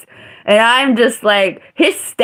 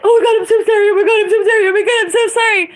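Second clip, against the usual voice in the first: much higher, panicky mock apology, fast at the end; frantic apology; 12 semitones higher, much faster than usual. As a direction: squeal out a frantic, grovelling sorry. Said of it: "He's like".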